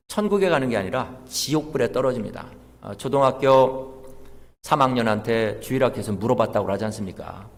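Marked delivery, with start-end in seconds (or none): none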